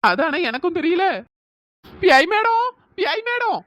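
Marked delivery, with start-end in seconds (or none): 2.0-3.6 high, loud shout to summon. Acted. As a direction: bellow it out to be heard. Shouting at the silent ghost.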